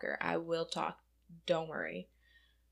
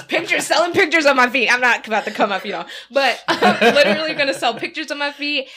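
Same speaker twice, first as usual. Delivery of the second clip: louder, laughing rant; 4 semitones higher, 11 dB louder than usual. Real, not acted. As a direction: laugh it out loud. Excited rant about selling feet.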